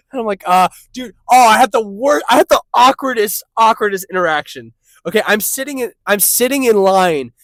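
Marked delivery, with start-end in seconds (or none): 0.5-4.7 louder, higher, bursting to tell it; loud, eager retelling; worked-up storytelling. Retold or imitated. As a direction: blurt it like you can't wait to share. Acting out telling the story.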